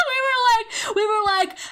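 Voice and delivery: high giggling, words breaking into laughter; can barely talk for laughing; laughing hard. Real, not acted. Retelling the French waiter story to chat, laughing hard.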